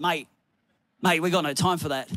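low, lightly imitated Aussie tradesman; blokey Aussie accent, pitched low. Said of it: "In his Aussie accent".

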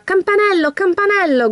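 high-pitched mock shout in storytelling; narrator's restrained yell, high and falling; calling out loudly. A narrator's reading. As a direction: call the horse's name, pitched well up. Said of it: "gridò a squarciagola".

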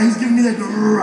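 ordinary talk, nothing marked

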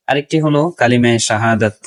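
ordinary delivery

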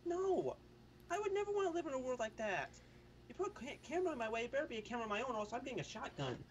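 high-pitched mock outrage; indignant refusal. Mock outrage at surveillance idea.

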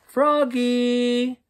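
high loud drawn-out call of Froggy; mother calling out from bed; yelling mother. A narrator's reading. Mother woken by the crash, yelling for Froggy. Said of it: "yelled his mother".

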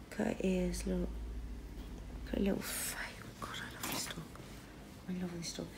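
soft, breathy cooing over a pet; overwhelmed by cuteness. Real, not acted. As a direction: melt over the dog's little face.

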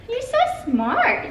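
high, slow praise through a laugh; delighted laughing praise. Real, not acted. Praising toddler, laugh heard.

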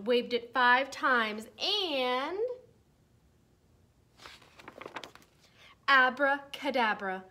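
slow, loud build into abracadabra; big spell. Acted. Teacher building up to the spell, slow and loud. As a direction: wave the wand five times, drawing it out. Furious Winnie casts spell again.

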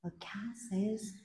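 soft whispered hint; whispered prompt. Shown as a demonstration. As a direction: whisper the answer as a cue. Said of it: "What does the cow say?".

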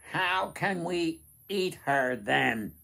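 high, loud, slow goblin question; puzzled goblins asking eagerly; curious goblins. Acted. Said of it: "the goblins asked curiously".

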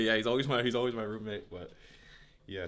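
usual speaking voice

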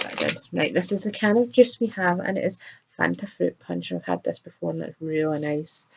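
ordinary delivery

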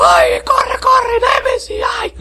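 hammed-up terrified scream of a scared player; mock panicked shriek.

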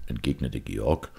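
plain reading voice

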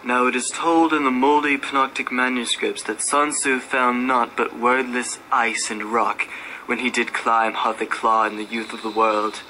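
usual speaking voice

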